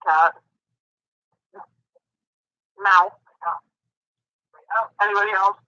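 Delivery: ordinary talk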